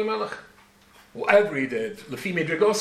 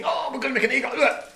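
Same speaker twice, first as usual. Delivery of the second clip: higher-pitched, lightly acted fluster; mock panic; 8 semitones higher, faster than usual. The people at Har Sinai deciding on an eagle, mock-panicked. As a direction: imitate a crowd in a mild panic. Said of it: "So they're all panicking".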